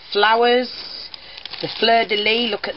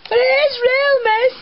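put-on upset child's cry; 4 dB louder than usual. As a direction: mimic a tearful little boy insisting. Quoting upset crying pupil.

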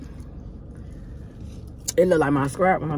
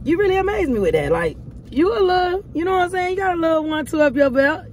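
excited praise; 10 semitones higher than usual. Real, not acted. Praising partner's cooking.

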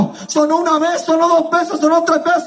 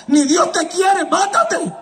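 devil's urging; 3 semitones higher, slower than usual. Retold or imitated. Devil to a despairing man after the unanswered call, urging. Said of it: "volvió la maquinación del diablo. Me decía".

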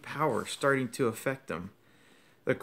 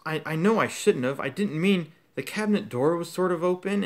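higher, louder, rushed excuse; flustered gasping; 6 semitones higher, 5 dB louder than usual. Acted. Harry caught out, apologizing to the professor, flustered. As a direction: stammer out an apology. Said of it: "Harry gasped".

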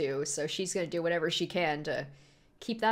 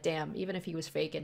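usual speaking voice

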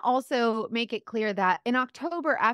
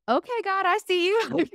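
higher, awed "I see you"; amazed realization; 10 semitones higher, 3 dB louder than usual. Retold or imitated. Speaker realizes God is calling her back, awed. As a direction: catch on to God's plan with delight.

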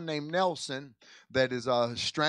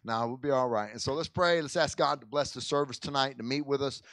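ordinary talk, nothing marked

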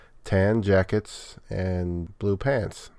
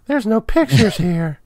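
put-on kid voice; 14 semitones higher, 6 dB louder than usual. Joking as a bored kid reader.

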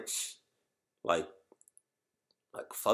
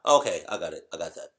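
ordinary talk

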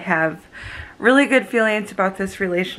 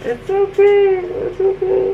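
much higher, louder cooing comfort; soothing reassurance; 13 semitones higher, 7 dB louder than usual. Real, not acted. Girlfriend soothing her teary boyfriend, high cooing reassurance. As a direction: coo it's okay to calm him down.

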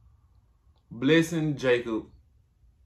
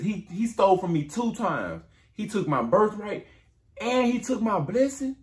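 high, rising aggrieved complaint; Esau complaining; 8 semitones higher than usual. Retold or imitated. Esau complaining to his father, rising higher with grievance. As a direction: climb higher with each grievance. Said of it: "he says".

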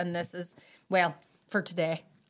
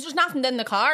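higher, louder retold protest; lightly acted insistence, pitched up; mock protest; 5 semitones higher, 5 dB louder than usual. Suspect tells policeman the car is empty, protesting.

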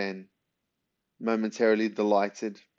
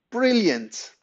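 excited; 12 semitones higher, 4 dB louder than usual. Acted. Ben leaps up delighted.

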